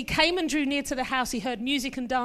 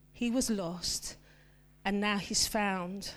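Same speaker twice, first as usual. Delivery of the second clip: softer, lower prayerful voice; quiet reflective reading; 4 semitones lower, 5 dB quieter than usual. Acted. Preacher guiding listeners to hear the father, tender. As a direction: speak it gently as the father's comfort.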